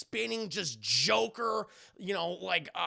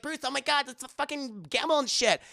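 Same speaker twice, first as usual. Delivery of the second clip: higher, louder mock girlfriend voice; lightly voiced exclaiming date; blonde bombshell; 6 semitones higher, 5 dB louder than usual. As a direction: protest in a higher imitated voice. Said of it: "Even the blonde bombshell".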